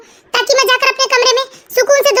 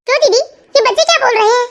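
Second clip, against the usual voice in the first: normal talking voice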